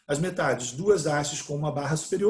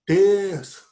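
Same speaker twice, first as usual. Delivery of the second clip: emphatic terço; 3 semitones higher, 7 dB louder than usual. Shown as a demonstration. Teacher joking with students, repeating terço louder for emphasis. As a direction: call out terço like a punchline. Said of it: "uma sílaba por terço".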